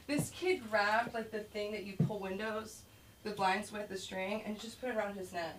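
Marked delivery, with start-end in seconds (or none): none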